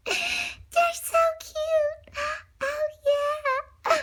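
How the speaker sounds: high, slow cooing; slow high baby-talk gush. Acted. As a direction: coo over the tiny puppies. Cooing over puppies.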